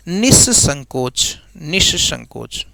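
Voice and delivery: निसंकोच slow and falling, said softly; slow dictation. Shown as a demonstration. Teacher to students, correcting and repeating slowly. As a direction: sound out निसंकोच slowly for them to copy. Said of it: "निसंकोच नहीं".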